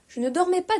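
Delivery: high, feeble sleeper's protest; lightly husky, just-woken voice; weak, husky voice. A narrator's reading. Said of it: "d'une voix faible et enrouée".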